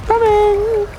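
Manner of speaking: very high eager shout; answering a teammate with a high, eager cry; excited call. Real, not acted. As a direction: call out brightly that you're on the way. Calls out to teammate.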